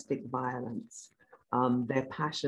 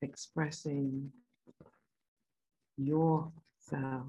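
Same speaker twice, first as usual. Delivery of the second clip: guided meditation; 3 dB quieter than usual. Acted. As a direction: calmly guide them inward.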